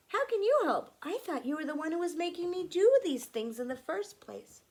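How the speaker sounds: put-on high, loud puzzled child; Anne's voice. Anne questioning her anger, high and loud.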